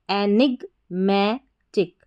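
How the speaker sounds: एनिगमैटिक stretched out slowly; slow blend. Shown as a demonstration. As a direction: draw the whole word out slowly. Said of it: "T I सी टिक". (धीरे खींचकर)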